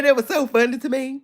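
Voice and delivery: ordinary delivery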